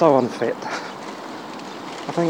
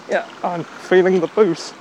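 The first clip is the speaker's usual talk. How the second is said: ordinary talk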